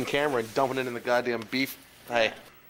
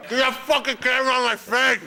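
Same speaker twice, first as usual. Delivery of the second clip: angry shout; 9 semitones higher, 3 dB louder than usual. Acted. Repeated takes, shouted.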